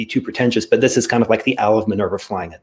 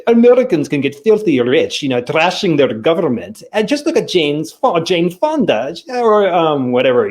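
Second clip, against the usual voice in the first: higher-pitched, louder mock Soviet accent; 6 semitones higher, 4 dB louder than usual. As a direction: imitate the defector in a hammed-up Soviet accent. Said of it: "Soviet accent".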